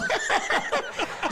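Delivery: breathy high-pitched burst of laughter; high airy laugh. Real, not acted. Cracking up after joking that exposure means no pay. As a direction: laugh out at the punchline. Laughing at his own joke.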